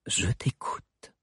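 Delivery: slow whispered triumph; triumphant whisper. Acted. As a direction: gloat under the breath. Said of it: "triompha la reine". (murmure triomphant, lent)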